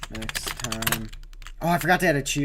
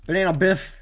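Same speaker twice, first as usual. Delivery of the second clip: "Banana Biff" called out louder and higher, like a fun announcer; playful nickname; 6 semitones higher, 7 dB louder than usual. Shown as a demonstration. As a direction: sell the nickname with a grin. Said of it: "that's what I should have put on the name reel".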